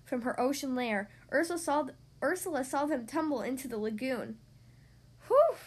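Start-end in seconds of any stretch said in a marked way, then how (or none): 5.3-5.6 high, loud sigh of relief; relieved whew. Acted. Witch relieved.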